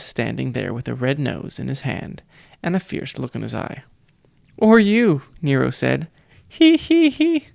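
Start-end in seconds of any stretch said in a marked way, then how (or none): 4.6-5.2 bright loud retort, pitched up; loud, high exclamation. A narrator's reading. Nero loudly answering the coach. 6.5-7.5 very high nasal-free titter, sneering; high mocking giggle "he he he". A narrator's reading.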